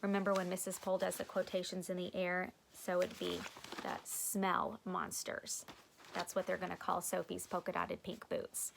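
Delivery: plain reading voice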